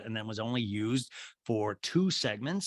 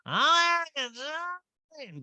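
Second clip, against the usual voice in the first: [name] impression. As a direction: belt the name out like a fan. Crowd in unison, imitated.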